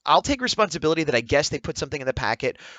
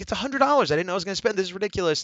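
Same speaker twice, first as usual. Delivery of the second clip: high-pitched protest without full volume; retold yelling; 6 semitones higher, faster than usual. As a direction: re-enact an angry outburst, held back. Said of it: "I started yelling".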